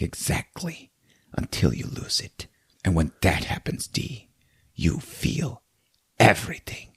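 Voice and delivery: soft unvoiced whisper, ominous. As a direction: lean in and whisper it like a secret. Lambert answers, menacing.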